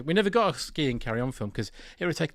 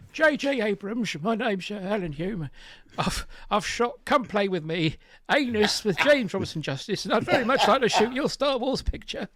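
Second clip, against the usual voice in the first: put-on higher pitched, chirpy old-school film-crew gent; old-fashioned stock voice; 8 semitones higher than usual. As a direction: play the eager old cameraman calling everyone Governor. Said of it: "I'd like to hear a bit more of him".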